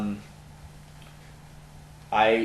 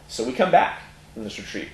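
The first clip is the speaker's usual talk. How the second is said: normal talking voice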